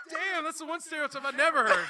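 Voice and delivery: talking high and loud through laughter; cracking up mid-sentence; laughing. Real, not acted. Laughing at the stereotype.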